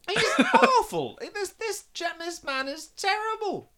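high-pitched, drawn-out outraged exclamation; real exasperation. Real, not acted. Podcaster rants about an awful king, exasperated. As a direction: exclaim in real disbelief, stretching the words.

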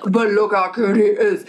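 cooing over the protest; doting parents. Acted. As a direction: gush obliviously in a put-on baby-talk voice. Parents cut in, cooing over child.

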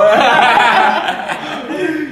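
high, loud burst of laughter; big laughing fit. Real, not acted. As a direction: laugh out hard at the joke. Group laughs at the story.

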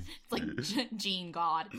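ordinary delivery

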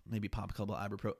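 ordinary talk, nothing marked

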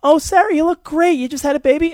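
a high, fawning "you look great"; high gushing voice. Retold or imitated. A friend gushing to Sarah after the baby, high and bright. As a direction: gush over her like a well-meaning friend. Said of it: "people that would say".

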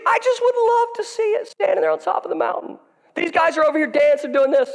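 preacher hamming up Elijah's mockery of the prophets; laughing full-force preaching. Real, not acted. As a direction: shout and laugh through the taunts.

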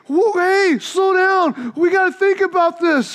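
high, slow anxious pleading; panicked plea. Retold or imitated. Scared boy begging classmates on the walk, mock panic. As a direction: beg them to slow down, nervous. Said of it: "I'm like".

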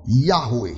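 Yahweh said YAH-weh, falling; Hebrew name Yahweh. Shown as a demonstration. Preacher giving the Hebrew name, YAH-weh. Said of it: "Another description of God".

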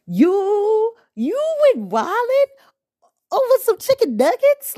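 high, loud incredulous question; can't believe he flipped over this. Real, not acted. Mocking the man wildin' over nuggets, high incredulous.

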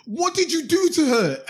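high shouted question, imitating a shocked woman; high-pitched imitated shout; mock shout. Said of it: "the first thing this woman shouts is".

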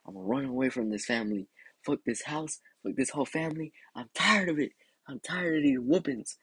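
put-on high, fed-up kid's complaint; retold mad. Kid vowing to run away from family, high mock-mad rant. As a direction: vow it in a high mock-angry whine. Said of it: "I was mad as hell".

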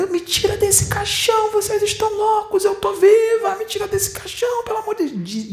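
very high, frantic begging; desperate plea from the coffin. Retold or imitated. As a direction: beg to be let out, panicking. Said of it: "E ela".

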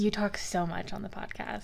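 soft teasing whisper. Pretend. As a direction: whisper it back mockingly.

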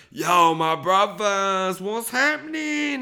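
slow, high put-on Jamaican greeting; a hammed-up Jamaican accent greeting. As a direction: drawl it like a laid-back Jamaican dude.

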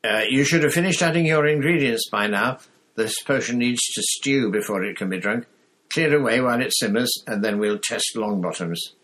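loud teacher's call across the room. A narrator's reading. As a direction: project to the whole class. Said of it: "Snape called".

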